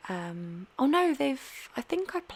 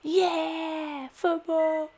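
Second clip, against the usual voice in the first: high, loud football lad voice; laddish bloke voice; 9 semitones higher, 3 dB louder, much slower than usual. Retold or imitated. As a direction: play a brash football-mad neighbour. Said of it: "the other side are like".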